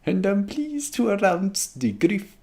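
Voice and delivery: high, slow attempt at Scottish; bad Scottish accent. McGonagall starting her announcement, bad Scottish accent. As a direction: try Scottish and let it fall apart. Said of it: "I actually cannot do a Scottish accent".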